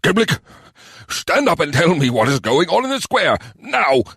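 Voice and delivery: high-pitched, forceful order; exasperated command. A narrator's reading. Mayor ordering the cowering goblin to stand, exasperated. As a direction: order him sharply, punching the last word.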